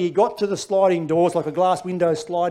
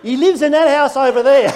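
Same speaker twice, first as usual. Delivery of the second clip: high, loud comic blurt; laughing panicked suggestion; panicked excuse; 8 semitones higher, 10 dB louder than usual. Retold or imitated. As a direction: blurt it out in a hopeful rush. Comic panicked thought, pointing away.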